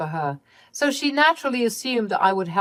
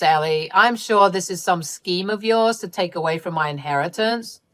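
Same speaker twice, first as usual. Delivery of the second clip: ordinary delivery, nothing marked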